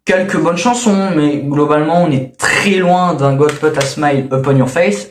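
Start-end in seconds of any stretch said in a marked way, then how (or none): 3.4-5.1 English title in a French accent. Real, not acted. Reviewer citing a song title, French-accented English. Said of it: "là encore très bel accent anglais".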